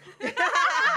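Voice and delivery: loud high burst of laughter. Real, not acted. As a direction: cackle at your own brag. Laughing at own boast.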